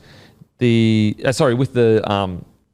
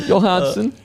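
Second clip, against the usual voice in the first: mock Swedish "Johansson", higher and lilting; playful fake Swedish; Swedish accent; 7 semitones higher than usual. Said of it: "I need to work on my Swedish".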